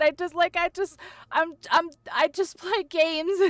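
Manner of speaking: high, strained, overwhelmed near-crying; fighting back tears. Real, not acted. Streamer overwhelmed by viewers' gifts, trying not to cry. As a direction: protest the kindness while holding back real tears. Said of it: "I'm trying so hard not to cry".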